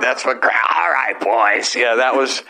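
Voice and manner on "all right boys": growled chain-smoker voice; chain-smoker mom. Acted. Comedian doing his stage voice for his mother, chain smoker. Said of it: "What about a chain smoker?".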